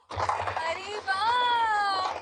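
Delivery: long drawn-out cry of delight; overjoyed wonder. Acted. Boy finding his lost comics, overjoyed. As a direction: stretch it out in happy amazement.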